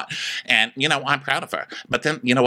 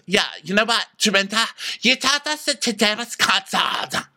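Tamara; 7 semitones higher than usual. Acted. Accusing Dorinda about the concert, high mock voice. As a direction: pitch it up and stretch the ends. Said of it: "And Tamara goes".

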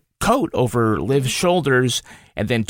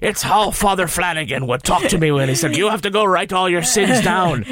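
hammed-up Irish accent, higher; fake Irish accent; 4 semitones higher than usual. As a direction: lay on a bad Irish brogue. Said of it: "fake Irish accent".

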